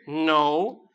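ordinary talk, nothing marked

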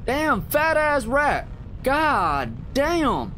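disgusted shock. Real, not acted. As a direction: recoil at the rat. Finds dead rat.

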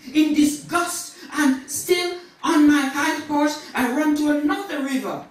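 high, forceful storytelling from the pulpit; impassioned preaching. Acted. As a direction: act out the sinner's flight with fervour.

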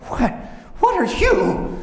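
high, loud, incredulous cry; shocked wolf. Acted. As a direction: demand it in alarmed disbelief. Wolf shocked at man from stomach.

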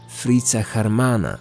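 ordinary delivery

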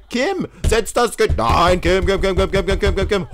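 loud, high, frantic calling of the name; exasperated repeated yelling; yelling at Kim. Real, not acted. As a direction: shout her name over and over to stop her. Yelling at game character Kim.

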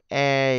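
"A" as a long "e-y" glide; E glided into Y. Shown as a demonstration. Teacher showing students the diphthong, drawn-out e gliding to y. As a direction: stretch "A" loudly from e into y. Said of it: "kết hợp giữa chữ E và chữ Y".

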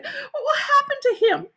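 put-on high, tearful girl's question; crying. A narrator's reading. Sheila fearing for the hurt boy, high tearful wail. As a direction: ask it in a worried wail. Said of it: "Sheila cried".

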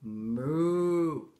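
long, low moo; slow humming cow call; pig demonstrating moo. A narrator's reading. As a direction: draw the moo out low to teach it. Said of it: "said the pig".

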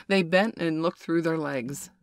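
usual speaking voice

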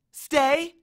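high, loud order; dog command. A narrator's reading. Annabeth orders the monster to stay, commanding. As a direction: command a huge dog firmly. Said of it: "Annabeth ordered the monster".

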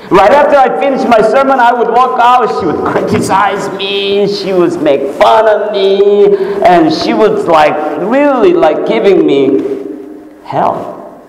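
high-pitched, excited preacher's delivery; impassioned preaching. Real, not acted.

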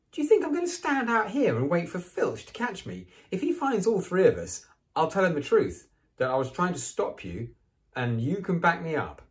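high, loud indignant protest, easing at the end; high-pitched huffy tirade. A narrator's reading. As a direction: argue back in outrage. Hermione argues indignantly with Ron.

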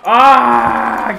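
long, loud yell; alarmed scream. Real, not acted. Player being jumped in game, alarmed scream. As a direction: scream while fending off an attacker.

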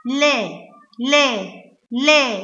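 "لا" repeated as separate la syllables; letter sound. Shown as a demonstration. Teacher asks pupils what she pronounced, "لا" as a distinct la. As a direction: sound out "لا" as an open la, pausing after each. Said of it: "أنا نطقت إيه؟".

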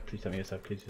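normal talking voice

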